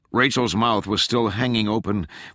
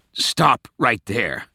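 slow, higher, firm order; commanding cut-off; 4 semitones higher, 4 dB louder, much slower than usual. A narrator's reading. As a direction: halt them, word by word. Tench cuts Rachel off.

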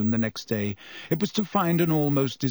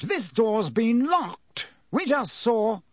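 higher, slow blustering; exasperated minister; 10 semitones higher, slower than usual. A narrator's reading. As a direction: reason with him, flustered. Fudge voiced, higher.

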